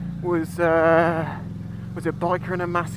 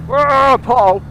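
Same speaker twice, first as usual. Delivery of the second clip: loud startled whoa; startled shout; 6 semitones higher, 8 dB louder than usual. Real, not acted. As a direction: yelp in surprise. Sudden scare on the bike.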